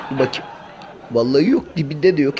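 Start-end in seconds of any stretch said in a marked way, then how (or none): 0.1-0.5 softer, peering into the pot; muttered to self. Acted. Cat peering into the pot, muttering softly. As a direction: mumble it while looking inside.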